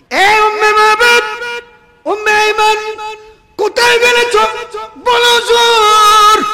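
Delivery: the Prophet calling out to Umm Ayman, high and loud. Acted. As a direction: call her from far away, high and strong.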